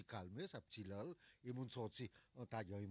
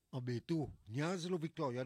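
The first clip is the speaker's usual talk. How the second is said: loud exclamation; 4 semitones higher, 9 dB louder than usual. Real, not acted. Host exclaims about the rain.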